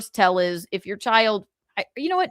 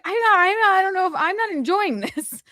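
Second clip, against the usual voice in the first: retold protest; 8 semitones higher than usual. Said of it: "I'm like".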